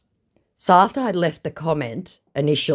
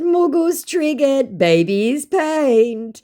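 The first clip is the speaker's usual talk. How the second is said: high, slow, louder singsong taunt; mocking taunt; 12 semitones higher, 6 dB louder, much slower than usual. Real, not acted. Taunting the giggling scammer on loudspeaker, slow singsong mockery. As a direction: drag each word out to needle him.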